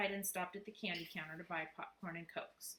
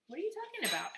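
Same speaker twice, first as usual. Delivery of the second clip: much higher mocking copy of his brother; mocking mimic; 13 semitones higher, 4 dB louder, faster than usual. Brother mimicking brother, high mocking echo. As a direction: parrot it back, higher and teasing. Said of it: "Alex mimicked".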